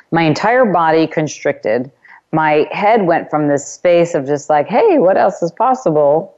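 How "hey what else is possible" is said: higher-pitched, light and open imitation; open, carefree. Host recalling her open headspace that morning, carefree lilt. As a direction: re-enact an open headspace with a lifted voice. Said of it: "the energy and tone of my voice can show you that".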